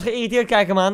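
ordinary delivery

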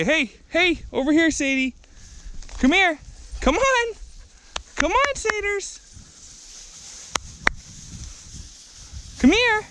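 high, bright sing-song call to the dogs. Real, not acted. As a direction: coax the dogs over in a high voice. Calling the dogs to come.

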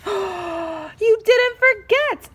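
delighted group shout; joyful slow exclaim; happy yell. Acted. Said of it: "everyone yelled".

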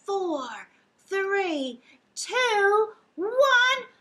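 from plain to very high, loud shout; building countdown to blast off. Acted. Counting down with the kids, rising to a shouted blast off.

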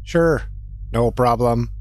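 lightly voiced ship computer; a hint of a calm robot ship. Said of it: "I gotta do it for ship now".

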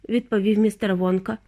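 ordinary delivery, nothing marked

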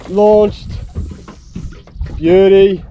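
loud burst of delight at a good cast; real excited shout of beauty; excited cheer. Real, not acted. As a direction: call it out, pleased with the throw.

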